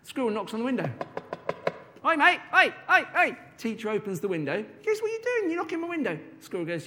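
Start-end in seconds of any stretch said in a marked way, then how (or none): 2.0-3.4 high, loud cheeky squirrel voice; a bold little squirrel banging on the glass; cheeky squirrel calling. Acted. 4.8-6.2 a lightly high, put-upon teacher; a teacher fed up at the window. Acted. Teacher answering squirrel.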